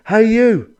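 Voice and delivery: loud call across the street; shout. Retold or imitated. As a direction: yell to get the guide's attention. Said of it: "And I shouted".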